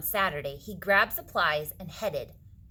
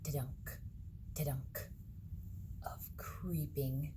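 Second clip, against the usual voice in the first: soft, spooky whisper; eerie hush; 5 semitones lower, 8 dB quieter, slower than usual. A narrator's reading. Narrating creeping carrots in a hushed whisper.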